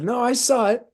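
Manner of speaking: put-on high, meek young Marine voice; high innocent young Marine; young driver. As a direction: answer like a sheepish kid. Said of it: "He said".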